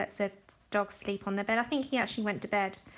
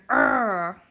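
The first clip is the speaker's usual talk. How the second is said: a loud, lower mock "Arrgh"; imitating a chat troll's loud grunt; mock troll yell; 5 semitones lower, 7 dB louder than usual. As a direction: growl out a silly troll "Arrgh". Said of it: "stepping into a chat going".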